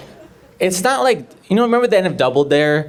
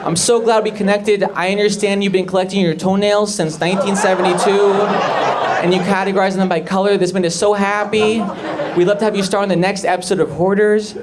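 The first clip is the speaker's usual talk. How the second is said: higher, quick salesman patter; TV producer; 4 semitones higher than usual. Acted. Producer signing a hoarder for the show, eager high voice. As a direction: gush through the offer in a raised voice. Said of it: "And he's like".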